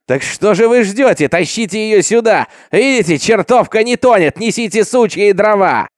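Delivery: high, urgent shouted orders; roaring mob. A narrator's reading. Crowd orders wood brought for the burning, shouting. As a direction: urge the others on, loud and high. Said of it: "толпа ревела на берегу".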